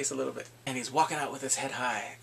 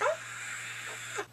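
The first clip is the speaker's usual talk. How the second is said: high, thin stifled shriek; mock scared scream; 5 dB quieter than usual. Bully scared by her reminder, mock shriek. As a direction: imitate a frightened squeal. Imitating him scared again.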